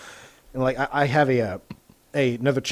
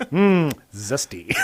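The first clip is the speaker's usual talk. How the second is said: mock connoisseur; much slower than usual. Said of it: "It's like".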